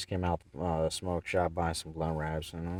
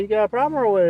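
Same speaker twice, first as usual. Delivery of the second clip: much louder, high-pitched demand; confrontational challenge; 10 dB louder, faster than usual. Retold or imitated. Driver confronts man leaving the store, challenging. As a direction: throw down a loud retold challenge.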